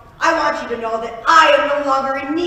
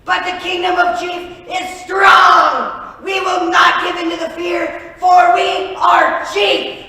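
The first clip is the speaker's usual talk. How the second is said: loud, slow defiant rallying cry; rallying shout; 7 semitones higher, 9 dB louder, slower than usual. Acted. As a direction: rouse the troops. Rallying speech.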